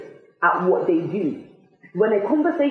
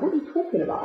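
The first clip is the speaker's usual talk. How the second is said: puzzled; 8 semitones higher, 4 dB quieter, much faster than usual. Retold or imitated. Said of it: "and I was like".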